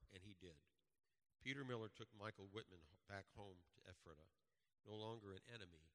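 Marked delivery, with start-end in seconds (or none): none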